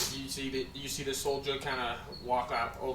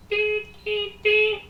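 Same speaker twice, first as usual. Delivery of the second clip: high, loud electronic beeps; imitated beeper; 10 dB louder, much slower than usual. The guy pulls it out, high beeps. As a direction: mimic a pager's piercing beeps. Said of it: "pulls it out and goes".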